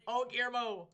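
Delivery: loud, high cry at a near crash; startled exclamation. Real, not acted. Racer reacting to Guillermo's near wreck, startled exclamation.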